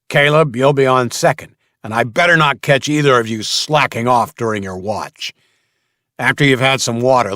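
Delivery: loud, stern gang leader; commanding boss. Acted. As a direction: bark out the orders. Mason assigns watches.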